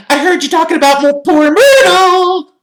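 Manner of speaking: very high, loud poltergeist voice; Peeves. A narrator's reading. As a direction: taunt in a piercing shriek, drawing out the end. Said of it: "said Peeves".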